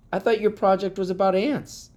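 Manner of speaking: high-pitched questioning teacher; surprised teacher. A narrator's reading. Teacher to pupil about changed project, surprised. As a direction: query it, pitched right up.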